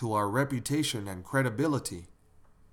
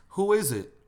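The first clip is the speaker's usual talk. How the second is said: answering the doorbell; 8 semitones higher, 4 dB louder than usual. Acted.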